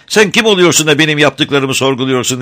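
high, loud, indignant retort; furious boy. A narrator's reading. Boy lashes out at the woodcutter, angry high shout. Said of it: "öfkesinden kıpkırmızı kesilerek".

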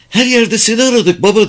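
high-pitched, excited call. A narrator's reading. Daughter riding up to her father, calling out high. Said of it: "diye bağırdı".

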